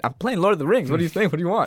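high, innocent cartoon boy; mock Butters voice. Butters answering the kids, high innocent voice. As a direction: pitch up, sound guileless. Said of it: "Butters starts humping up on people".

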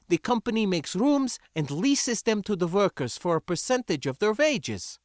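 put-on heated, high-pitched accented lecture; scornful foreign rebel. Sindra telling the spacer how workers live, indignant. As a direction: explain it impatiently, voice pitched well up.

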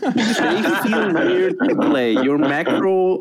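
slow, laughing through the words; laughing while talking. Real, not acted. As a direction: let a laugh run through it.

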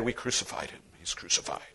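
soft, hushed pious claim; mock pious whisper. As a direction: whisper it like a smug believer. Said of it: "No, no, Pastor".

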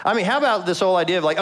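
ordinary delivery

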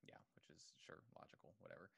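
dismissive whisper, breathy and low; whispered aside. Real, not acted. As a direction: whisper it like you barely care. Dismissive aside during friend's point.

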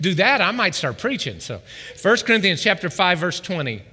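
usual speaking voice

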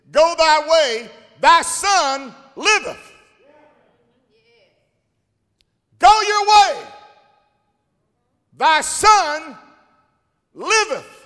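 high, loud and slow proclamation; preaching at full force. Acted. Preacher proclaiming Jesus' words to the nobleman. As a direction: ring it out from the pulpit.

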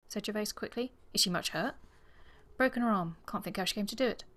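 measured, lightly acted story narration; novel read aloud. A narrator's reading. Reader sharing the declaration scene with co-host, composed narration. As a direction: read the story out with gentle character touches.

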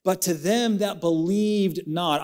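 high, slow solemn reading; scripture reading. Real, not acted. Preacher re-reading verse eighteen to the church, high and slow. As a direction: read the verse aloud with weight. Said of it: "He says in verse eighteen".

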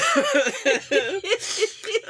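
high, squealing giggles; giggling fit. Real, not acted. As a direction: dissolve into real high laughter. Laughing fit goes on.

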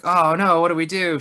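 frantic, half-joking cry for help; mock panic. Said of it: "it's like".

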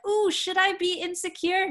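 high, loud, put-on fretting; mock insecurity. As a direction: play up a wounded self-doubt. Mocks reacting to a critic's jab.

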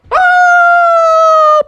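loud high cartoon scream; long piercing yell on the way down; acted scream, high and sustained; falling scream. Koala falling off cliff.

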